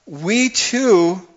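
loud, high, sweeping sermon delivery; fiery preaching. Acted. Preacher calling the congregation to repent, full-force preaching. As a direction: drive it home to the pews.

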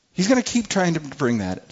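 ordinary delivery

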